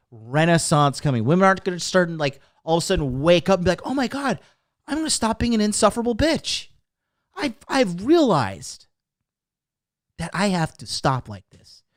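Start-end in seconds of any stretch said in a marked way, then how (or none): 3.8-11.8 raised pitch, a lightly put-on mocking woman's voice. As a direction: lightly parody a sudden epiphany, higher up. Mocking how women would talk.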